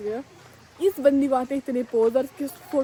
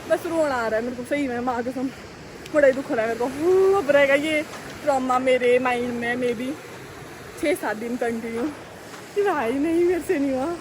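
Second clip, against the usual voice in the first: ordinary delivery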